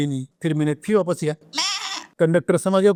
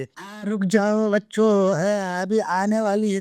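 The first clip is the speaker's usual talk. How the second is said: stalling host; 5 semitones higher, slower than usual. Retold or imitated. Stage host holding audience.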